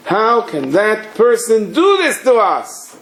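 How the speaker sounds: high, drawn-out outraged protest; mock indignation. Acts out hurt, indignant reaction.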